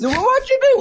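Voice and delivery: high-voiced friend. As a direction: ask it up in the squeaky register.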